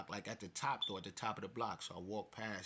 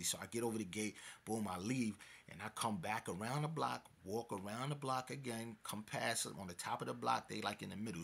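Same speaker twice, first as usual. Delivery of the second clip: ordinary talk, nothing marked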